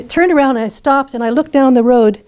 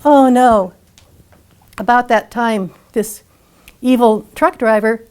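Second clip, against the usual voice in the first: usual speaking voice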